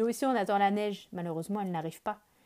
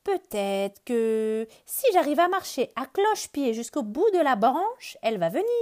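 louder hopeful rabbit voice; rabbit announcing; 5 semitones higher, 7 dB louder than usual. Acted. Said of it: "annonce Lapin".

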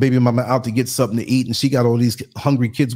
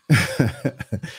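brief amused chuckle; short chuckle; 3 semitones lower than usual. Real, not acted. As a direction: chuckle to yourself. Laughs at own joke.

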